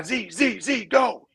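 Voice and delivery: high, urgent coach call from the bench; retold yell. Coach yelling at a daydreaming player to go, urgent call. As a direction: bark it like a coach sending a player out. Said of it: "And he's yelling".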